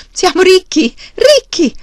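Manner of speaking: very high, loud, jubilant cry; wildly exuberant shout; whooping excitement. A narrator's reading. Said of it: "saltellando e schiamazzando".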